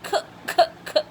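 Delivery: "k" puffed out three times like little coughs; short, separate "k" bursts; cough sound. Shown as a demonstration. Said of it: "I cuff again".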